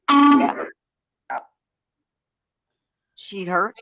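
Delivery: usual speaking voice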